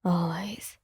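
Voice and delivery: low, soft and sincere; a quiet gruff promise; gruff but sincere. A narrator's reading. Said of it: "his voice gruff but sincere".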